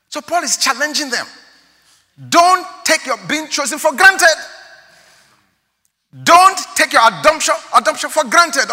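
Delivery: high, loud sermon cadence; preaching at full force. Acted. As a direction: press the point hard on the congregation.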